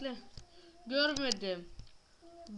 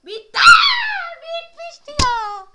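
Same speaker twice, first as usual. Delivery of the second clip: very high, loud wail; frustrated shriek; 12 semitones higher, 16 dB louder than usual. Real, not acted. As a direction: wail it in dismay. Shrieking frustration game ended.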